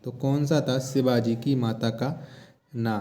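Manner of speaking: ordinary talk, nothing marked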